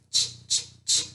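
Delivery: "ch" repeated as a voiceless burst; digraph sound. Shown as a demonstration. Teacher sounding "ch" for her class, voiceless. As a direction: tap out "ch, ch, ch" crisply. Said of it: "Which digraph says ch".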